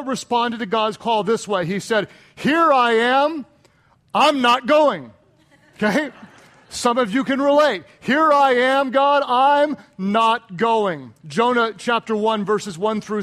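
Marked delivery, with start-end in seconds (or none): 2.3-5.1 mock Jonah. Preacher voicing Jonah's refusal. 8.0-11.1 loud, stretched-out refusal; mock Jonah. Preacher voicing Jonah turning God down, drawn-out mock refusal.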